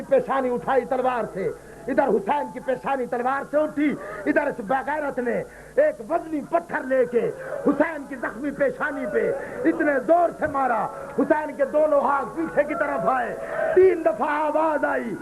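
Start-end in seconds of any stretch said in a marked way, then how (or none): none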